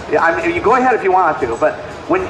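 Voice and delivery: normal talking voice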